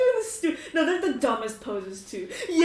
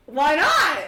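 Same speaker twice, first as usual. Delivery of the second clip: raised, loud retort; acted defiant comeback; 5 semitones higher, 8 dB louder than usual. Flexer answers why he is flexing, loud and defiant. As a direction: throw it back loudly, pitched up.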